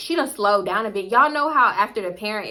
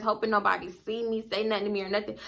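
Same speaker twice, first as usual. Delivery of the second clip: ordinary delivery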